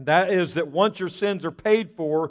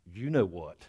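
low, soft and knowing; knowing punchline; 10 semitones lower, 8 dB quieter than usual. Acted. Chastened parrot to the customer, low knowing punchline. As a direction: drop the voice for a sly understatement.

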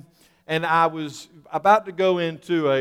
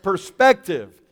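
perspective punched louder, set apart; stress on perspective; 5 dB louder, slower than usual. Shown as a demonstration. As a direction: hit the word perspective with extra force. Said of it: "me emphasize that word".

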